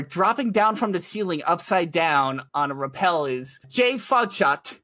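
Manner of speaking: ordinary delivery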